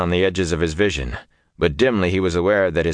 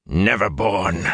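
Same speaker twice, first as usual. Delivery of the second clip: spat; slower than usual. A narrator's reading. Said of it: "young bull spat".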